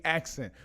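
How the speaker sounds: "accent" said as "axin'"; axin' for asking. Shown as a demonstration. Host telling viewers what we do today, axin' for asking. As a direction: swap the sounds of asking so "accent" comes out "ax-in". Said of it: "We ain't asking".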